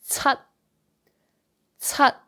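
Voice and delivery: "cut" begun with a hissy ts, final T unreleased; Cantonese ts onset. Shown as a demonstration. Teacher models seven, "cut" said as stopped "tsat". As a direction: start "cut" as "tsat" and stop it short. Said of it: "it pronounced ts".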